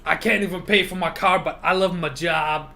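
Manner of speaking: mocking an underpaid worker who loves her job; sarcastic mimic. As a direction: drag out love my job mockingly.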